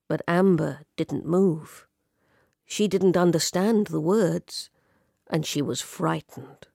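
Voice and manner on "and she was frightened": ordinary delivery, nothing marked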